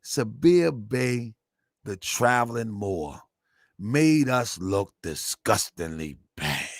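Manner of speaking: each word laid down slowly and heavily; slow solemn emphasis. Real, not acted. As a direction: repeat it slowly, as a grave verdict. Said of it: "I'ma say it again".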